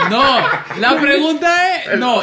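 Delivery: high, slow words broken by laughter; laughing. Real, not acted. Explaining the game to friends while laughing. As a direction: laugh through the question.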